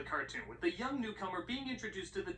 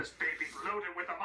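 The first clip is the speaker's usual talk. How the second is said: ordinary talk, nothing marked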